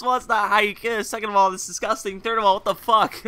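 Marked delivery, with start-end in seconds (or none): none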